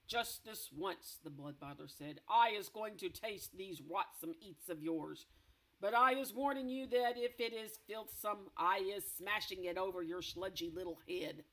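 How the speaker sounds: grumble, then threaten loudly; threatening giant. Acted. Blood Bottler warning the BFG, grudging then loud threat.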